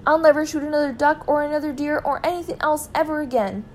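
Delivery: high, sustained frantic vow; desperate pleading cry. A narrator's reading. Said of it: "cried Mister Gregg".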